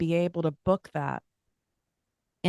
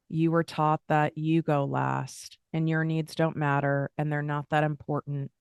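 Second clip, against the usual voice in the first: ordinary talk, nothing marked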